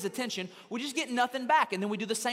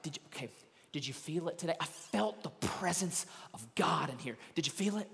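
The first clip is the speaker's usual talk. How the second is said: phony religious person; 5 semitones lower, 5 dB quieter than usual. Retold or imitated. Preacher mimicking phony religious people asking if you felt it, hushed mock piety. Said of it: "they say stuff like".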